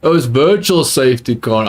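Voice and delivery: ordinary delivery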